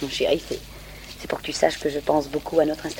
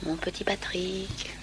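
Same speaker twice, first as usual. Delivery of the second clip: slow breathy seductive whisper; siren voice; 2 semitones higher, 10 dB quieter, much slower than usual. Acted. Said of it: "je prendrai ma voix de sirène".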